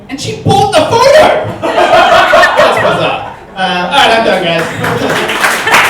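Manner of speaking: high, loud cry of disbelief through laughter; laughing disbelief. Real, not acted. Telling the crowd the mum bought the photo, laughing. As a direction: blurt the punchline, cracking up.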